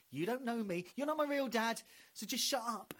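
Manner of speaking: high, loud petulant retort; hammed-up stroppy teenager; mock sulky teen. Comedian snapping back at his own inner voice like a teen.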